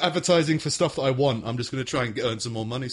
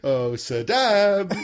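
drawn-out, higher mock fussing; doting dog owner; 5 semitones higher, 4 dB louder, much slower than usual. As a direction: coo slowly over a naughty dog.

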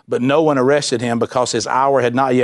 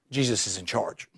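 hushed emphasis; 6 semitones lower, 12 dB quieter than usual. Acted. Preacher's key point, dropped quiet.

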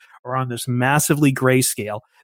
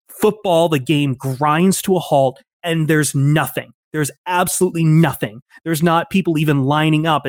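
usual speaking voice

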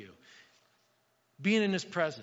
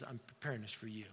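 hushed God promise; 8 semitones lower, 14 dB quieter than usual. Acted. God's promise, voiced softly.

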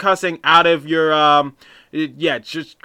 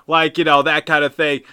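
ordinary talk

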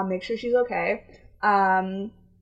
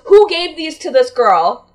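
loud, higher, slow indignant call; borderline screaming; 6 semitones higher, 10 dB louder than usual. Retold or imitated. Worker asking colleagues about the forms, borderline screaming. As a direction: call it out accusingly across the room. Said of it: "borderline screaming at me".

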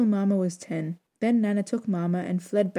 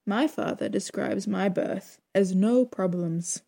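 ordinary delivery, nothing marked

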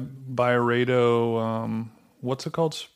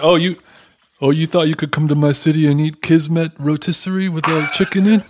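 louder, higher tough-guy challenge, hammed up; mock city boss; 4 semitones higher, 4 dB louder than usual. City boss to visitor who didn't check in, mock threat. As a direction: put on an indignant boss confronting an intruder.

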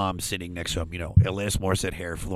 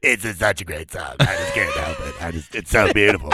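lowered, lightly put-on pirate sob; tearful pirate, half-hearted and lower; 4 semitones lower than usual. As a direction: choke it out in a mock pirate voice. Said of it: "with my pirate voice".

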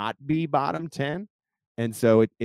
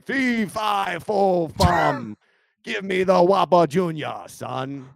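booming, higher giant chant; 8 semitones higher, 7 dB louder, slower than usual. Acted. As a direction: bellow it like a fairytale giant. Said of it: "your giant voice".

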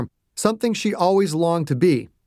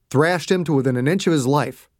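usual speaking voice

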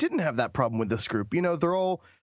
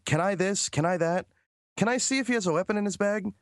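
put-on higher, hesitant kid questions; mimicking eager kids; 7 semitones higher, faster than usual. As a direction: ask like a nervous beginner. Imitating new players' questions.

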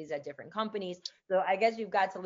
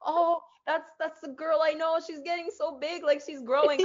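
high, gushing proud parent; proud mom voice; 10 semitones higher, 3 dB louder than usual. Retold or imitated. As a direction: coo it like a doting aunt. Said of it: "sense of pride".

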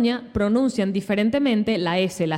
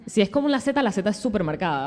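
normal talking voice

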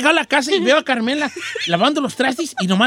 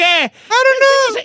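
mock crying wife; 12 semitones higher, 4 dB louder, much slower than usual. Wife crying, imitated by husband.

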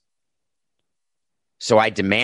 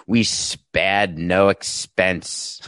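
very slow, badly faked Australian accent; mock Australian accent, long drawn-out vowels; attempted Australian accent; 3 semitones lower, much slower than usual. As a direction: stretch each word in a dubious Australian accent. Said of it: "in an amazing Australian accent".

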